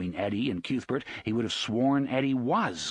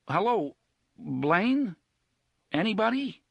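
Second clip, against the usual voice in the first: nervous calling out; 9 semitones higher, 3 dB louder than usual. Acted. Eddie calls nervously into intercom.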